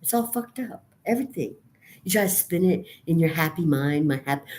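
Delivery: ordinary talk, nothing marked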